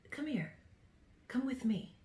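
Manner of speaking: luring negative thought. Acted. Negative thought calling the listener over, soft and luring. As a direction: beckon softly, in short pieces with pauses between. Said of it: "as those negative thought forms".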